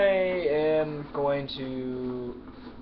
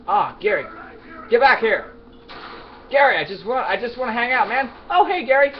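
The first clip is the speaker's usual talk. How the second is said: higher, louder sing-song calling of a buddy; mock friendly taunt; 9 semitones higher, 9 dB louder than usual. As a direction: lure him in with fake sweetness. Taunting an enemy NPC named Gary.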